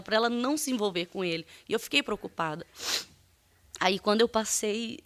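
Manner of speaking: normal talking voice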